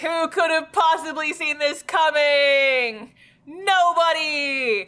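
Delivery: high, slow theatrical sarcasm; hammed-up disbelief, stretched long; mock astonishment. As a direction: proclaim it with drawn-out fake shock. Sarcasm about predictable twist.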